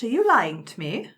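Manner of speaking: ordinary talk, nothing marked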